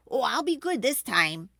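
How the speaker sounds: lightly voiced father pleading to be good; Pa promising. A narrator's reading. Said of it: "Pa promised".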